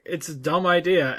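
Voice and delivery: normal talking voice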